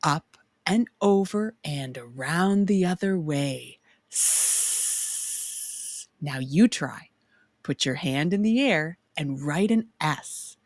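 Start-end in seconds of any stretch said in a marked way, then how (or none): 4.1-6.1 long unvoiced hiss on S; drawn-out s like a snake. Shown as a demonstration. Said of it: "I sound like a snake".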